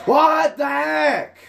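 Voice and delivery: high, slow, drawn-out furious yell; real angry yell. Real, not acted. Gamer raging at his game, real angry yell. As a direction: yell it out at the screen. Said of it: "I sometimes need to yell".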